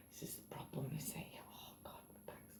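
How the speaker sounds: whispered groan of pain; hushed and worn out with a sore back; exhausted whisper. Real, not acted. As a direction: murmur it, back aching.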